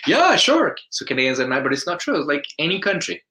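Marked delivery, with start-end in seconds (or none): 0.1-0.8 high, loud cheery reply; friendly clerk. Retold or imitated. Canadian clerk lending the phone, high cheery yes.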